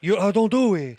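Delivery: loud mock Italian accent; mocking Italian imitation. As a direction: mimic his words as a caricature Italian. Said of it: "Isn't that what he sounded like?".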